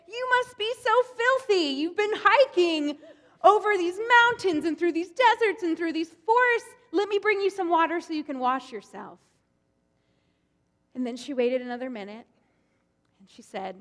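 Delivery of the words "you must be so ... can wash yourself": high-pitched welcoming patriarch; kindly old Abraham. Acted. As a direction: fuss over a weary guest like a doting old host. Abraham voiced in told story.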